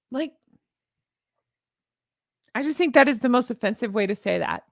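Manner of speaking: ordinary talk, nothing marked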